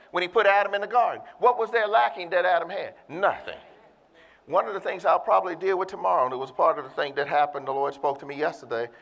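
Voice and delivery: ordinary talk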